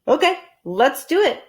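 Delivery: Harry voiced. Harry agreeing to help, eager. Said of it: "said Harry".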